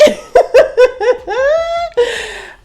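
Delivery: very high, long laugh; squealing laugh. Real, not acted.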